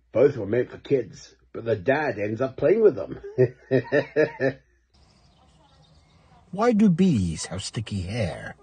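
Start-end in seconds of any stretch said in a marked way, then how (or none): 3.2-4.5 real chuckle at own joke; corny dad laugh. Real, not acted. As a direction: laugh at your own punchline.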